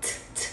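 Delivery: voiceless breath bursts on "Ch"; aspirated wind sound. Shown as a demonstration. Teacher demonstrating pinyin c, "ch" read as pure breath. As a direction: puff out "ch" with air only. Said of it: "bật hơi, đọc bằng âm gió".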